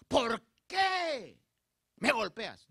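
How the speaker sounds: loud, high, indignant demand; exclaimed reproach, not a question; demanding exclamation. Shown as a demonstration. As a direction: shout it as a complaint. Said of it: "Donde quizás hubiera dicho".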